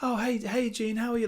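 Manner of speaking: very high, sing-song greeting; gushing high-pitched mock hello; fake-friendly mum. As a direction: imitate mum's sudden sweetness to an acquaintance. Mum's fake-friendly greeting acted out.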